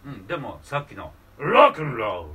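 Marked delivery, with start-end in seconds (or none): 1.4-2.3 loud rough growled shout. Shown as a demonstration. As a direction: growl it hard. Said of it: "うなり声".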